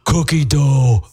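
slow mock echo of her delivery; mimicking the co-host. Host echoing co-host's cookie dough, mimicking. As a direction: draw it out, teasing.

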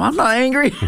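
high-pitched, comic protest; mock angry denial. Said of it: "Arguing and yelling".

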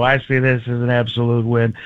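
a little air in the voice; Mark Ruffalo. Shown as a demonstration. Comedian morphing one impression into another, airy voice. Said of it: "add a little bit of air, it becomes Mark Ruffalo".

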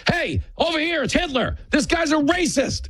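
very high, whiny street-hustler call. Retold or imitated. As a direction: shout out like the glasses con man. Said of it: "Democrats ... walking down the street going".

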